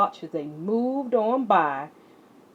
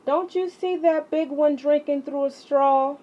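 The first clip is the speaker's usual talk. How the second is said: higher, teasing grandma voice; playful grandmother; 8 semitones higher than usual. Acted. Nana teasing CJ about the thirsty tree, playful. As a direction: coax the boy with a playful tease.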